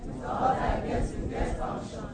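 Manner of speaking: hushed, voiceless repeat of the line; whispered recitation. Shown as a demonstration. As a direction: whisper the quote back. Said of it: "What did I say?".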